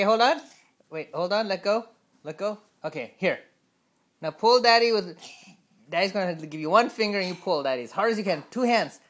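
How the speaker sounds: ordinary talk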